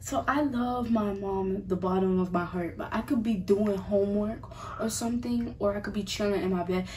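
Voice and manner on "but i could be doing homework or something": usual speaking voice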